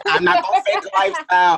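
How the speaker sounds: high, loud laughing words. Real, not acted. Joking she calls the cops, laughing loudly. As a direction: say it through laughter. Laughing about calling police.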